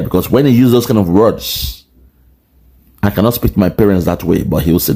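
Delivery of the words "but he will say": ordinary delivery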